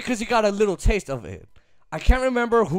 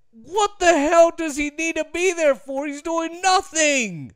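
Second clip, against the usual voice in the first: heated rant; 8 semitones higher than usual. Real, not acted. Ranting about the coach.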